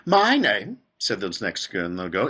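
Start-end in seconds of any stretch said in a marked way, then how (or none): 0.0-0.8 put-on high, loud girl's voice; Veruca Salt. A narrator's reading. Next girl introducing herself at the gates, high and loud. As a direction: announce yourself in a loud, raised child voice.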